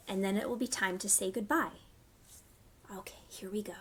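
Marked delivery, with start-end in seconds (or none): 2.9-3.7 whispered cue. Real, not acted. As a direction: hush them before the song starts. Cue before the song.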